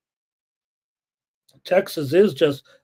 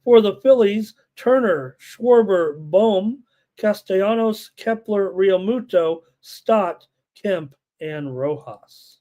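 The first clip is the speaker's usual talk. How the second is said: higher-pitched roll call of names; lineup announcement; 4 semitones higher, 3 dB louder, slower than usual. Real, not acted. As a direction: read out the lineup name by name, winding down slowly on the last. Reads out team lineups.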